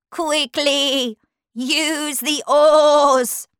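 high, drawn-out bleated order; bossy sheep voice. Acted. Sheep orders Alice to row, bleating insistently. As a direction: bleat it out as a command.

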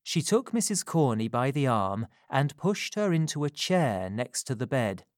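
plain reading voice

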